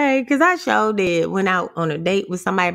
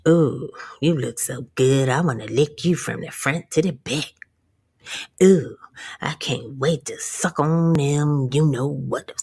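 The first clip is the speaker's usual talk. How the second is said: creepy date; 9 semitones lower than usual. Retold or imitated. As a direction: play the leering date, voice dropped low. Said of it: "he was like".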